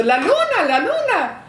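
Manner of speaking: put-on little girl thrilled by the street light; excited child. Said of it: "yo estaba diciendo".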